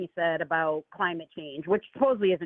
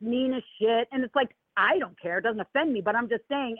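ordinary talk, nothing marked

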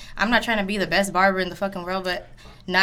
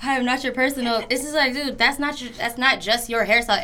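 higher-pitched, mock-indignant telling-off; retold telling-off; 4 semitones higher than usual. Barber recounts going off on a client, mock indignant. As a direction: retell going off on him, half amused. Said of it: "I had to go off on him".

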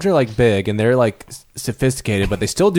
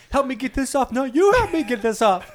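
higher voice, squabbling over a leash; high squeaky bickering; 11 semitones higher than usual. Pretend. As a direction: squeak at each other to get it off. Two tiny humans bickering.

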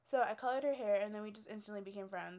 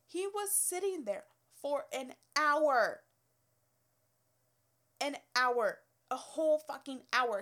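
loud outraged stress on hour, louder; indignant emphasis; 3 semitones higher, 9 dB louder than usual. Real, not acted. Telling viewers about the waiting man, loud and outraged. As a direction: hammer how long he waited.

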